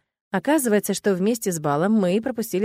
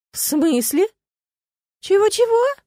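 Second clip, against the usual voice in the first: much higher, louder disbelief; shocked squeak; 13 semitones higher, 7 dB louder, slower than usual. Acted. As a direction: blurt it out, stunned. Friends shocked at wedding question.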